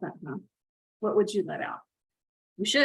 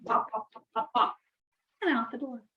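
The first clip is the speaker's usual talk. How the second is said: ordinary delivery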